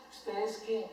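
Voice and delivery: ordinary talk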